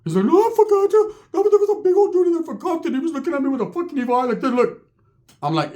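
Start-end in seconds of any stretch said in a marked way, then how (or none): 0.2-4.9 very high voice, easing down a little; squeaky excited impression; Ricky's high-pitched voice. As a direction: rattle it off in falsetto. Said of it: "He has that high-pitched voice".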